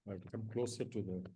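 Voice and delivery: faint, far from the mic; quiet and distant; barely audible. Real, not acted. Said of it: "Your voice is very faint".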